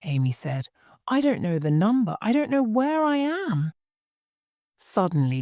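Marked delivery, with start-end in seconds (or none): 1.1-3.7 higher-pitched rising distress; acted panic, voice climbing on the second sentence; frightened. Lost girl on the phone to her friend, frightened.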